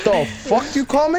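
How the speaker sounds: indignant challenge. Real, not acted. Player reacting to teammate's insult, indignant and high. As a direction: rise sharply into an offended question.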